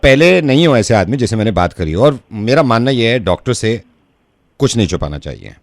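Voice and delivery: low, measured doctor voice; discreet doctor. Acted. Prank doctor calls about a private matter, discreet low voice. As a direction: speak low and carefully, like a consulting doctor.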